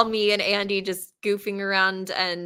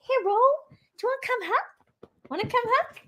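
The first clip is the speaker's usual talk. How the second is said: pet talk voice. Real, not acted. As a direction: coax the dog, very high. Calling the dog over.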